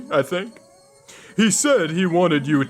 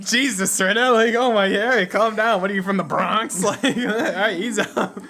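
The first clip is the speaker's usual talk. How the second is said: higher, startled plea to calm down; a rattled friend backing off; startled protest; 6 semitones higher, 3 dB louder than usual. Acted. Voices a startled character.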